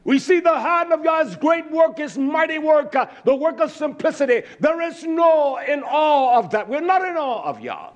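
high, driving sermon delivery; fervent preaching. Real, not acted. Preacher rebukes the people for not magnifying Yah, fervent. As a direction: preach it hard, pressing on through each phrase.

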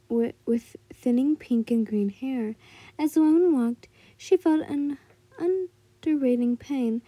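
ordinary delivery

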